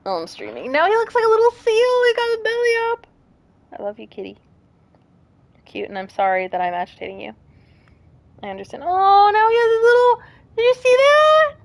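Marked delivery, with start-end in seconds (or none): 0.7-3.1 high cooing, a bit fast; cooing at the cat. Real, not acted. 3.8-8.8 much softer, tender words to the kitty; soft talk to the cat. Real, not acted. Softly talking to her cat.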